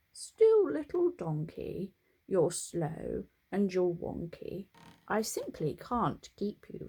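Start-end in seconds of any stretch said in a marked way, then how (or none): none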